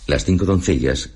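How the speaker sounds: ordinary delivery, nothing marked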